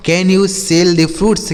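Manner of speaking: slow, careful English question; slow model reading. Shown as a demonstration. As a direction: read it out slowly for learners. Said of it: "slow model sentence".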